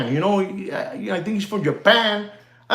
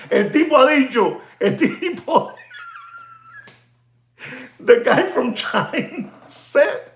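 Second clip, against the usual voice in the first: talking through real laughter; cracking up mid-sentence; laughing; 7 semitones higher than usual. Real, not acted. Telling what the guy from China said, laughing. Laughs while retelling the quote.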